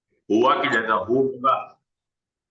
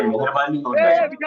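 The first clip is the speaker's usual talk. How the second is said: high, sing-song mock pleading; exasperated plea; 3 dB louder than usual. Real, not acted. Teacher pleading with students to mute the noisy mic, mock-sweet. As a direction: beg them sweetly through gritted patience.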